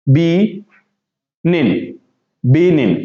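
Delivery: "बी निन" in two parts, silent G; long "बी", pause, then short "निन"; sounded out. Shown as a demonstration. Teacher showing students the word, split in two. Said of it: "और फिर क्या है".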